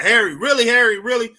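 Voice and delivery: mock-exasperated questioning; imitated disbelief. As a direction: react as if he's crazy, voice lifted. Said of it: "he looking at me like I was crazy".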